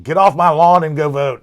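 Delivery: high, loud get-off-my-lawn holler; mock yelling. Older co-host nagging people to vote, mock yelling. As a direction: yell like a comic grumpy neighbor. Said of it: "I feel like I'm yelling at people".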